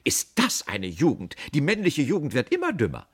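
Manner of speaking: loud, high, indignant tirade; scolding. A narrator's reading. Aunt berating the clueless apprentice, loudly scolding. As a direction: scold the young man at full voice. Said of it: "Meine Tante begann zu schelten".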